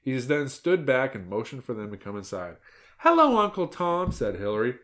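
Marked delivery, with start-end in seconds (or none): 3.0-4.1 put-on high, loud childish hello; greeting little girl. A narrator's reading. Hilary greets her uncle at the door, girlish.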